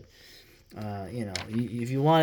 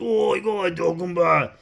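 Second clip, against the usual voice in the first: high, louder hammed-up Newcastle accent; mock Newcastle accent; 6 semitones higher, 5 dB louder than usual. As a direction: do the band's thick Newcastle accent like trolls. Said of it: "thick fucking Newcastle accents".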